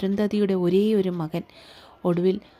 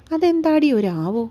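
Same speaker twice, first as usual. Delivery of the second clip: higher, louder mock-indignant retort; playful mild protest, pitched up; teasing indignation; 7 semitones higher, 5 dB louder than usual. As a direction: tease with a raised, incredulous question.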